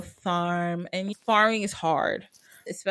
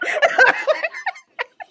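cracking up loudly; high laugh; 6 dB louder than usual. Real, not acted. Laughs at mom's line.

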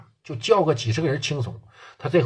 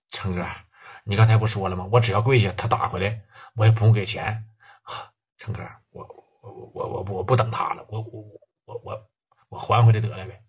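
timid stammering plea; 6 semitones lower than usual. Acted. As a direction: beg meekly, tripping over every word. Sun Yingjie meekly gives in.

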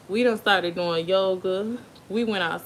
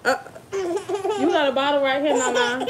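high-pitched, laughing reproach to a little one; real amused chiding; 7 semitones higher, 4 dB louder than usual. Real, not acted. Mother to child snatching bottles, amused chiding. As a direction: point it out in a lifted, laughing voice.

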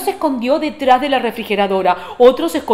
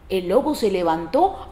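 usual speaking voice

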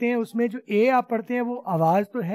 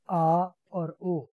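आ और ओ stretched very slowly; sounds drawn out; much slower than usual. Shown as a demonstration. Teacher to class, slowly modelling vowel sounds. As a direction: hold each vowel long. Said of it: "कौन सी?".

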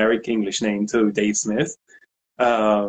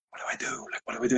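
urgent whispered panic at the door; 11 dB quieter, much faster than usual. Retold or imitated. Nervous whisper, asking his friend what to do. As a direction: hiss it under your breath, nervous.

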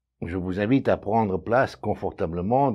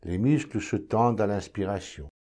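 calm, measured meditation voice, lower and softer; guided meditation; 3 semitones lower, 4 dB quieter than usual. Acted. Meditation guide leading listeners to notice their breathing, calm and unhurried. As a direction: guide the listener inward, with long silences between phrases.

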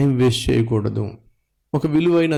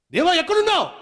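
high, strained imitation yell; mock prayer shouting; faster than usual. Preacher imitates believers screaming for God, high mock cry. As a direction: wail upward as if God were out of earshot. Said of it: "అరుపులే అరుపులు, కేకలే కేకలు".

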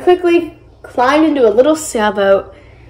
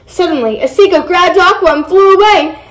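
plain reading voice

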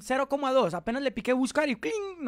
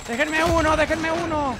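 loud pleading callout; 5 semitones higher, 6 dB louder than usual. Real, not acted. Begging teammates for a kill.

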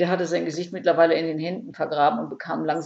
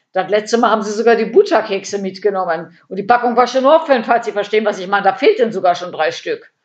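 loud, indignant lament; comic despair; 3 semitones higher, 8 dB louder than usual. A narrator's reading. Käthe laments her stolen biscuits, comically desperate. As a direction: pile up grievances in mock despair. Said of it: "rang in komischer Verzweiflung die Hände".

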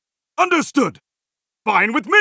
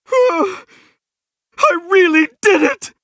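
high, breakthrough cry of triumph; amazed delight; 5 semitones higher than usual. Acted. Hero amazed at winning, high and thrilled. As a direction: exclaim it, thrilled and disbelieving.

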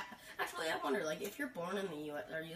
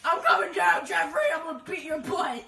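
loud, high mock-furious mother yelling; hammed-up angry mom shout; scolding mother; 6 semitones higher, 12 dB louder than usual. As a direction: holler a comic threat at your child. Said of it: "like a mother going to, like, scold my child".

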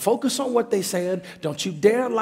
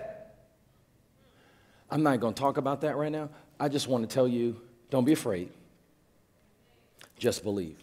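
calm Jesus; 5 semitones lower, 5 dB quieter than usual. Acted. Jesus answering Jairus calmly.

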